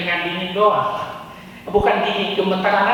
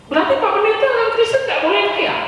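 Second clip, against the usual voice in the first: mock congregant question; 13 semitones higher, faster than usual. Preacher voices a congregant's objection, high and indignant. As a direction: ask it like a shocked listener.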